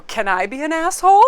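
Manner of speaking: loud, high, climbing question; mock-innocent question. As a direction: pipe up cheekily, rising to the end. Retold cheeky question to angry colleague.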